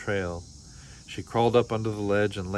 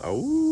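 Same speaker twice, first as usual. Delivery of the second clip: long, much higher howl at the moon; coyote howl; 4 dB louder than usual. Acted. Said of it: "and howled".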